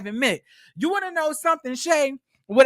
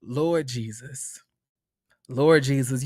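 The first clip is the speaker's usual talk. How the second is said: exasperated lament; 10 semitones lower, 3 dB quieter than usual. Retold or imitated. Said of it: "I was like".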